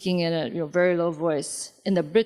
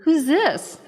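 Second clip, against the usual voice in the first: confused surprise; 8 semitones higher, 3 dB louder, slower than usual. Retold or imitated. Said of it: "we're like".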